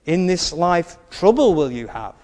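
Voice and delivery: usual speaking voice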